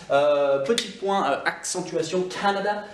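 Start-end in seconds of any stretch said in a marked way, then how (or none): 2.2-2.8 Canada with the first syllable punched higher; stress on first A. Shown as a demonstration. Teacher shows French learners where Canada is accented, first-syllable stress. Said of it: "L'accentuation se fait sur le A".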